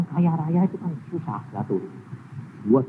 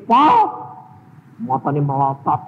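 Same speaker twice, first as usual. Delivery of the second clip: ordinary talk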